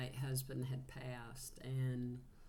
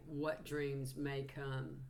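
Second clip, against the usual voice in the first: slow quote; slower than usual. Retold or imitated. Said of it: "she was like".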